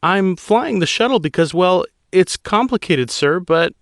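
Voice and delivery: high female pilot voice, tense and pleading; a rattled pilot explaining to an admiral; young woman pilot. Acted. Pilot Guano's voice.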